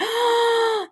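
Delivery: sharp high intake of breath; a sudden stunned gasp; surprised gasp. Real, not acted. Reacting to a moving message.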